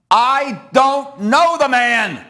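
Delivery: slow, loud and high, spitting each word; angry denial. Acted. Peter snapping at the bystanders by the fire, slow furious shout. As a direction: deny him with a slow furious blast. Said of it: "and now Peter gets angry".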